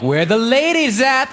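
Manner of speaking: high, slow, hopeful call; eager pick-up line. Retold or imitated. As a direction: toss it out like a hopeful flirt.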